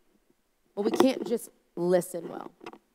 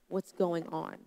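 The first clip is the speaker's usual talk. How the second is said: usual speaking voice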